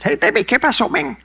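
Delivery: high, loud, fast Cuban greeting; Cuban Spanish greeting. Retold or imitated. Latin guys greeting him in Cuban Spanish. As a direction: toss it off like a Havana street greeting. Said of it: "I said".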